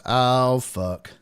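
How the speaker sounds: resigned groan. Retold or imitated. Host's resigned reaction.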